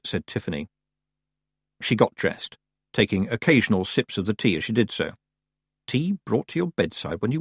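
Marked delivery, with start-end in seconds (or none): none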